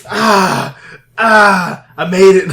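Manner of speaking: loud, mock groans of pain; milk the injury theatrically; hammed-up wounded hero. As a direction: pretend agony, pushed loud. Pretend wounded hero walking out.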